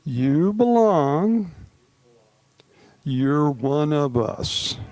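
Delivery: very slow and heartfelt, long pauses between phrases; slowly, with feeling. Shown as a demonstration. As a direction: let each phrase land, like a blessing. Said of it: "say it slowly and with feeling".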